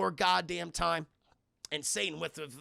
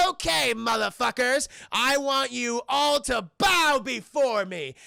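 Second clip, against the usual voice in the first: higher, loud, slow commanding voice; tyrant Saddam; 5 semitones higher, 8 dB louder, slower than usual. Retold or imitated. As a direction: boom it out like a dictator. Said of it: "He's like".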